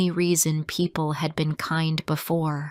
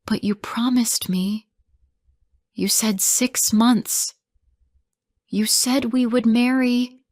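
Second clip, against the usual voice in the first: high, cracking hurt plea; pleading, voice cracking; 6 semitones higher, 3 dB louder than usual. Acted. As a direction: let the voice break as you plead. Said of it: "My voice cracked as I whispered".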